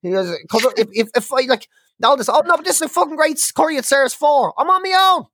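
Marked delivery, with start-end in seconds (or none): 2.3-4.6 mocking people. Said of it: "People say". 4.6-5.2 high, heated venting; fed-up complaint; exasperated rant. Real, not acted. Ranting about cooking alone.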